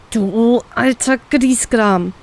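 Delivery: slow, venomous jab at her father; slow, irritated insult; irritated snipe. A narrator's reading. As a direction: spit the insult out slowly. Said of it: "gifte ich ihn gereizt an".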